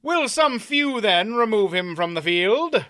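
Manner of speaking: courteous suggestion. A narrator's reading. Knight asks the crowd to carry off the loser, courteous. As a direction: suggest it politely to the watching knights. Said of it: "Mander Allen suggested".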